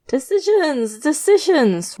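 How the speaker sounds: high, slow, savoring decisions; gleeful murmur. A narrator's reading. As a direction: relish each word gleefully. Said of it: "Roach Mask murmurs gleefully".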